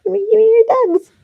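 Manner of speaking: high, loud, cutesy begging; squeaky plea. Real, not acted. Asking a viewer for dogs.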